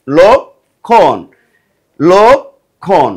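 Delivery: লক্ষণ drawn out very slowly as "lok-khon"; লক্ষণ spelled out slowly in sound, "lok-khon"; slow clear pronunciation. Shown as a demonstration. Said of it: "যদি আমরা এটা উচ্চারণ করি".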